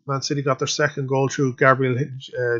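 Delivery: ordinary delivery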